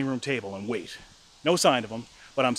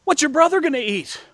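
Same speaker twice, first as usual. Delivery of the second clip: high, loud motherly protest; imitating a fretting mother; worried mom; 14 semitones higher, 6 dB louder than usual. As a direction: call out a concerned question, pitch raised. Mom's worried question, retold.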